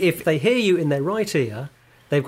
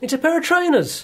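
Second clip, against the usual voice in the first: put-on high kid voice; 11 semitones higher than usual. Brother corrects, high voice.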